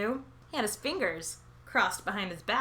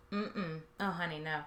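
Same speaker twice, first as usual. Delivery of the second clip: condescending no; 4 semitones lower, 9 dB quieter than usual. Acted. Pitying correction of the viewer.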